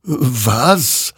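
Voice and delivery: taken aback; puzzled surprise. A narrator's reading. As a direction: blurt it out, wondering. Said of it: "wunderte sich dieser".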